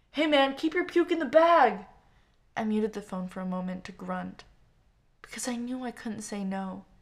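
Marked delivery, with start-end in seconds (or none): 0.1-1.9 put-on loud high drunken shout. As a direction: yell it across the bar. Shouted background at a bar.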